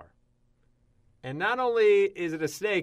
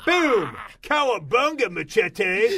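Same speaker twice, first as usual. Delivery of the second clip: excited cry; 6 semitones higher, 6 dB louder, slower than usual. Pretend. Ninja Turtle pizza joke shouting.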